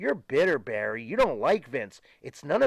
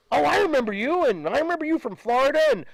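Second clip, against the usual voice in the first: much higher, quick, delighted recognition; imitating an eager security man gushing; recognizing guard; 10 semitones higher, 4 dB louder, faster than usual. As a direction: play the guard suddenly starstruck. Security guard recognizing him, retold.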